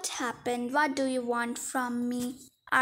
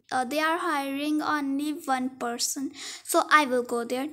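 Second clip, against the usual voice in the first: ordinary delivery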